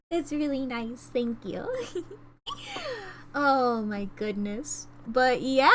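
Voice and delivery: normal talking voice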